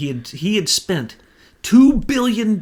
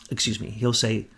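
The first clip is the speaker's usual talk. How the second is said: ordinary delivery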